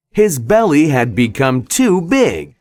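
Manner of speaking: ordinary delivery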